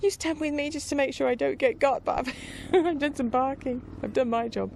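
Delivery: pleased with herself after her first bark; proud puppy voice. Retold or imitated. Puppy telling Mister Doncaster she did her job, high and chuffed.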